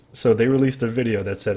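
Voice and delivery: usual speaking voice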